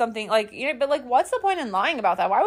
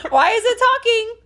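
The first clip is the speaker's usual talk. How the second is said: high, loud incredulous question; mock alarm; 12 semitones higher, 5 dB louder than usual. Imagining her reaction to the noise, high mock alarm. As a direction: cry it out high in mock disbelief.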